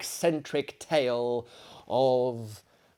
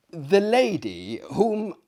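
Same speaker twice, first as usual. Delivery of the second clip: ordinary delivery, nothing marked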